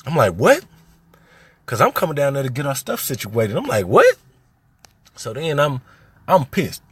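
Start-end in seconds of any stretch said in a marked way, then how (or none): 0.3-0.6 sharp high stunned "What?", loud; shocked what. Retold or imitated. Brother told at school his sister was taken, stunned. 3.9-4.2 "What?" flung up very high and loud, near a shriek; even more shocked what. Retold or imitated. Brother hearing it again, now fully incredulous. Hears sister taken from school.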